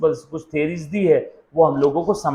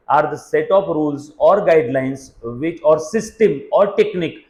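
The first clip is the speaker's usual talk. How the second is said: words spaced out very slowly for note-taking; teacher dictating a definition, very slow; slow dictation; much slower than usual. Shown as a demonstration. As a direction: dictate it slowly so they can write. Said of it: "लिखना है तो लिख सकते हैं".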